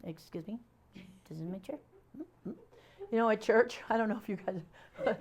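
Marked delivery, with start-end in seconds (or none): 0.0-2.5 soft, prissy, offended; quietly huffy claim to your seat; prim indignation. Retold or imitated. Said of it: "you'll be like".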